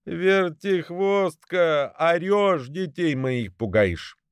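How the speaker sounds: high, slow-starting scolding; indignant mother goose; scolding goose wife. A narrator's reading.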